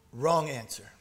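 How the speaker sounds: ordinary talk, nothing marked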